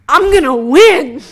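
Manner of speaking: gleeful boast. Retold or imitated. To a recovery crowd, mocking his own eager self, laughing boast. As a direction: crow it like you already won. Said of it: "And I'm like".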